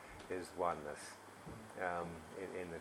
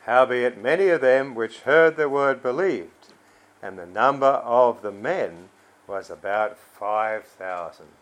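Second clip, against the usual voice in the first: loud Bible reading, easing down at the end; scripture reading; 8 dB louder than usual. Acted. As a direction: proclaim the verse, then settle on the final words. Reads scripture aloud to congregation.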